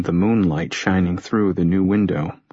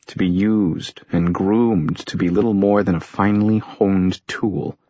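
ordinary delivery

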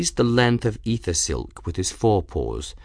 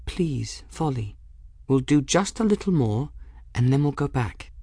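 soft, higher-pitched plea; gentle coaxing; 5 semitones higher, 4 dB quieter than usual. Acted. Bridget asking Folly to continue, gently pleading. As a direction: coax a nervous friend softly.

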